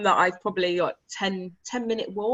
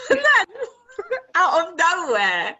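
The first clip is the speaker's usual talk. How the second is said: high, loud, cracking up with laughter; laughing disbelief; 10 semitones higher, 7 dB louder, slower than usual. Real, not acted. Telling a friend about the dog's surprise, laughing in disbelief. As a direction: laugh helplessly through the words, stretching them out.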